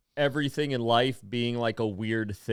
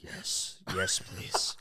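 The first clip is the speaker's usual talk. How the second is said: eager whisper; 7 semitones lower, 9 dB quieter, much slower than usual. Retold or imitated. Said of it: "And I'd be like".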